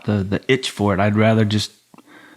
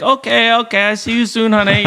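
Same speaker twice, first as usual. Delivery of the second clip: put-on Thai accent; 11 semitones higher than usual. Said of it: "Her little accent".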